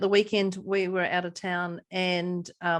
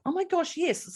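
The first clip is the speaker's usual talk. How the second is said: delighted realization; 9 semitones higher than usual. Retold or imitated. Delighted realization after laughing.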